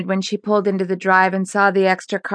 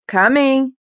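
higher-pitched answering call; calling back; 6 semitones higher than usual. A narrator's reading. Son answers his mother from his bedroom, calling back. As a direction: answer from another room. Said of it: "he responded from his bedroom down the hall".

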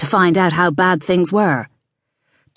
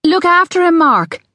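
high, louder call to her son; calling back; 12 semitones higher, 4 dB louder than usual. A narrator's reading. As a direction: call back urgently to the boy. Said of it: "Ali called back".

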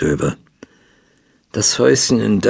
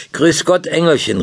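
higher-pitched cheery greeting call; 7 semitones higher than usual. A narrator's reading. Young man greets the girl next door, calling out. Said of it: "rief er".

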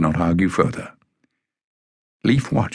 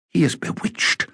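hushed, breathless whisper; a frightened whisper at the bewitched man; 11 semitones higher, 3 dB quieter than usual. A narrator's reading. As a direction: whisper it in awe and dread. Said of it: "Jasmine whispered".

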